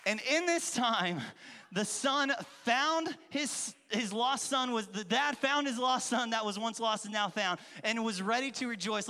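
normal talking voice

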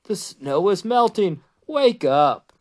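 mildly excited storybook call; crying out. A narrator's reading. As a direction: urge a sleeper up, lifting the voice. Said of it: "cried Frog".

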